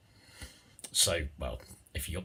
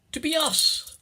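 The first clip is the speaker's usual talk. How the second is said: high, lilting Irish little-people voice; Irish accent; 11 semitones higher, 5 dB louder, faster than usual. Pretend. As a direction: play a wee Irish leprechaun. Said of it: "forgive the Irish".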